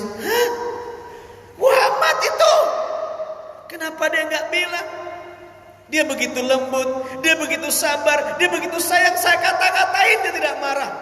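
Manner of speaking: the stunned beggar realising who fed him, pitched very high; shocked disbelief. Acted. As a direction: gasp it out, then wail.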